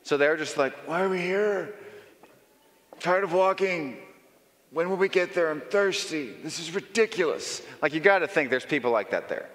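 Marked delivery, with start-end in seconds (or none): 0.9-7.6 put-on whiny, sulky young soldier; a touch of a grumbling young recruit; bad-attitude soldier. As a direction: complain like a bored punk soldier. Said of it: "the one with the bad attitude".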